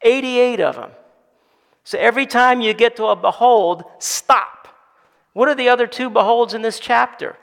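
loud, high, full-force preaching; impassioned preaching voice; fiery preaching. Acted. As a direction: drive it home to the congregation.

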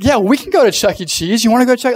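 high, loud imitation of eager parents; excited. Parents saying yes to the kid's weekend idea, excited. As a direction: leap at the suggestion with lifted pitch. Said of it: "they'd be all excited".

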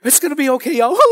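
tearful reassurance breaking into a high wail; mock crying. Pastor acting out comforting his family while crying, mock sobs. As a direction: comfort them, then dissolve into pretend boohoo. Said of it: "I'm crying and I can't stop".

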